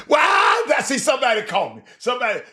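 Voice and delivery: high loud burst at a comment; excited shout at a viewer's comment. Real, not acted. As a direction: shout out with delight.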